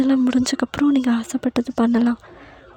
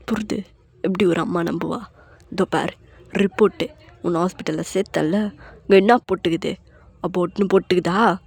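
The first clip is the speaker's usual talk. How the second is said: a hint of a lower, heavy husband's voice; lightly voiced grieving husband; 3 semitones lower than usual. A narrator's reading. Husband tells his wife the baby is gone, lower and heavy. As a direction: voice the broken husband confessing, low.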